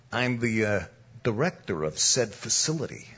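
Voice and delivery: low, soft East Coast wiseguy; mafia tough guy. Retold or imitated. Mobster explains his casino job, low wiseguy voice. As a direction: talk low like a mob boss. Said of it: "he says it just like this".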